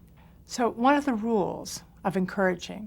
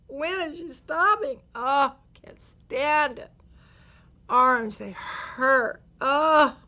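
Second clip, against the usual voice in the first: whiny groaning moans, climbing higher and louder; complaining; 6 semitones higher, 5 dB louder, much slower than usual. Shown as a demonstration. Teacher acts out pained complaining during the arm test. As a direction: moan and complain like your arms ache. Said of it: "start saying in your mind".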